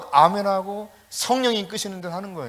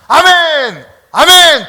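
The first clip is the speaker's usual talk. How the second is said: high, loud congregational cry; imitating a whole church's loud response; drilled amen shout; 10 semitones higher, 16 dB louder, much slower than usual. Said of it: "아멘을 그렇게 하더라고".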